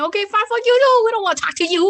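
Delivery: high, loud, fast comeback; irritated retort. Retold or imitated. He answers the party pooper who refuses, irritated.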